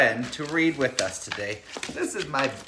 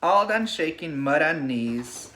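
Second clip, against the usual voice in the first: put-on reading to children. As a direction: lull the class like a bedtime story. Reads picture book to class.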